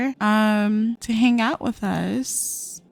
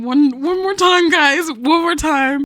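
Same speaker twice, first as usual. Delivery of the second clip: higher, louder begging for one more time; hammed-up desperate plea to her lover; pleading; 6 semitones higher, 5 dB louder than usual. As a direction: beg him dramatically, voice rising. Voicing Sim pleading with lover.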